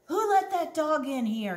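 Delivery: high, loud angry scream; screaming manager. Acted. Store manager yells about the dog in his store, screaming. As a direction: yell it at the whole store. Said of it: "he kept screaming".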